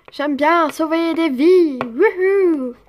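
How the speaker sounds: loud, slow, gleeful boast; drawn-out boast ending in a whoop; goofy rescuer. Acted. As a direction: crow it like a silly hero. Jean-Claude boasts of his rescue.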